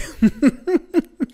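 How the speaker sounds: high-pitched burst of laughter. Real, not acted. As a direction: laugh at your own idea. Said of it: "pour rigoler". (éclat de rire aigu)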